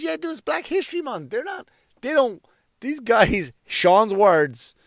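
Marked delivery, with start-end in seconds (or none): 0.0-3.5 high mock friend. Quoting friend Sean, high.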